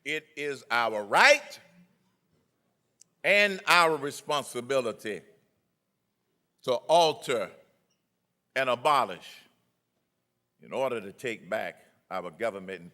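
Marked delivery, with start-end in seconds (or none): 0.0-9.5 slow emphatic oratory. Acted.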